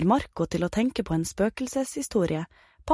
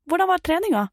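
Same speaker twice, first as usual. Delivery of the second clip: higher, louder coaxing mum voice; cheery mother; 12 semitones higher, 4 dB louder, faster than usual. Acted. Mum coaxes her son with his favourite dish, bright and eager. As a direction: sell the treat like a guilty mum. Mum asks about practice.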